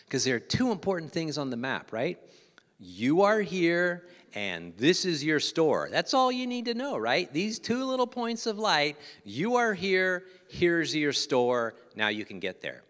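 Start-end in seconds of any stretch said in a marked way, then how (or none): 2.8-5.9 each word drawn out slowly and loud, you are here; slow emphasis. Acted. Pointing out two map points. 7.3-11.7 high and loud, you are here dragged out slowly; slow emphasis. Acted. Repeating the map points.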